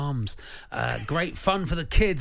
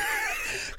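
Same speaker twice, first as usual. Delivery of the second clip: high squawking cackle; seagull squawk laugh; 4 dB quieter than usual. Retold or imitated. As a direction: screech like a gull. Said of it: "Sounds a bit like a parrot".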